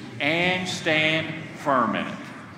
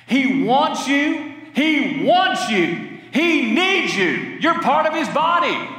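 tender; 7 semitones higher, slower than usual. Acted. Pleading tender repetition.

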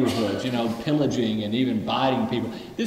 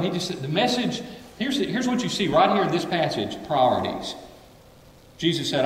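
normal talking voice

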